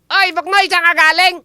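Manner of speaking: high cartoonish voice; comic character voice. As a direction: play a silly kid. Host voices Bardo in skit.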